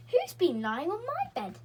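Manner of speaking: a hint of a gruff bear voice; lightly growled papa bear line; growly father bear. A narrator's reading. Father bear finds his bed used, a hint of growly. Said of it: "in a growly voice".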